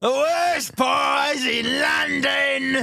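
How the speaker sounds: high, slow shouted Cockney cry; Mrs Lovett shout. Acted. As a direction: belt it like a music hall landlady.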